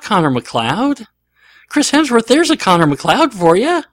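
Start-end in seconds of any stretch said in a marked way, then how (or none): 1.7-3.8 excited endorsement. Real, not acted. Enthusing over preferred actor.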